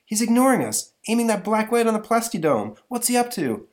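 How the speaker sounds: high urgent hero voice; excited hero voice. Acted. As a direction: raise the voice into a worried comic-book hero. Hero voiced in comic reading.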